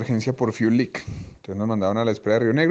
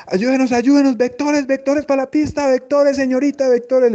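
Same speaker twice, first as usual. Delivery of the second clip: much higher, pleading imitation of a frantic pilot; desperate pilot; 12 semitones higher, 4 dB louder than usual. Pilot begging controller for vectors, desperate high voice. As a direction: beg for vectors like a pilot in panic. El man pidiendo ayuda.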